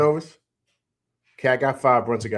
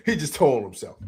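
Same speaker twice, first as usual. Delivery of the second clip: laughing. Real, not acted. Laughing at caller.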